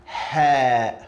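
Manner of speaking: 'Ha' like the H in house; Arabic letter Ha. Shown as a demonstration. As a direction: breathe a plain English H in 'Ha'. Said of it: "like H in English".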